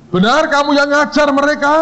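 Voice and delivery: high-pitched, insistent mock questioning; acted interrogator. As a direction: grill the teacher theatrically. Boss questions the lecturer.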